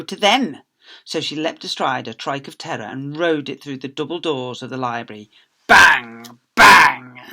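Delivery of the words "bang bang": shouted bang; loud bang effect. Acted. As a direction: punch the sound out. Sound effect of doors.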